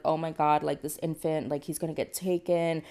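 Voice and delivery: ordinary talk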